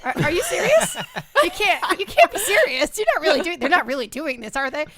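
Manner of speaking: can't believe they're taking the horse; disbelief. Retold or imitated. Streamer retelling his outrage as the game takes his horse, incredulous. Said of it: "I was like".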